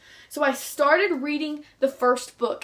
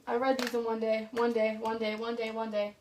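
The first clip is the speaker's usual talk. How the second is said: soft counting under the breath; low-volume repetitive mumble while checking; muttering to self; 3 semitones lower, 9 dB quieter than usual. Real, not acted. Vlogger counting which books took one day, muttering to self.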